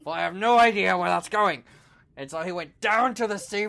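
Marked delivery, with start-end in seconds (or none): 0.0-1.6 alarmed warning. Acted. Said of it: "He was like".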